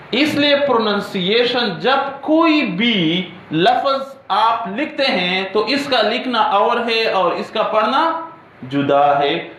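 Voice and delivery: usual speaking voice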